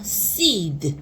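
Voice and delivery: normal talking voice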